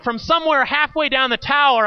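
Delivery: ordinary talk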